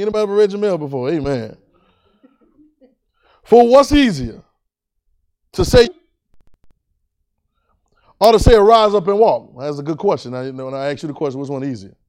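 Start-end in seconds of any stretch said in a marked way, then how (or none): 3.4-9.5 high, loud pulpit questioning; full-force preaching. Real, not acted. Preacher putting Jesus's question to the congregation, full-force preaching. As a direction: hurl the question out with big pauses.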